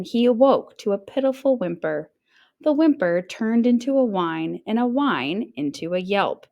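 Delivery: ordinary delivery, nothing marked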